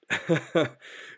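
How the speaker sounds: brief, light chuckle; short laugh. Real, not acted. Podcaster after admitting a troubled youth, short laugh.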